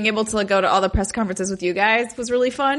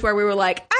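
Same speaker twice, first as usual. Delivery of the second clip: ordinary delivery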